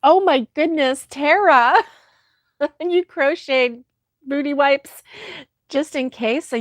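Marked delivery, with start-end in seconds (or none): none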